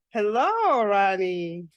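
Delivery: ordinary delivery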